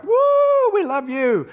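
high, loud whoop of a crowd cheering. Retold or imitated.

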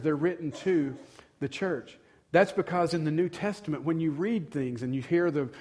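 usual speaking voice

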